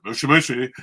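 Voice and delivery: quick Japanese phone answer; Japanese phone greeting. Retold or imitated.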